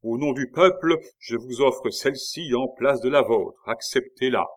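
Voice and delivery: loud, high public proclamation. A narrator's reading. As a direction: offer it boldly in the people's name. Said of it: "en haussant la voix".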